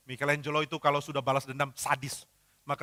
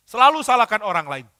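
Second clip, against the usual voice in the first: ordinary talk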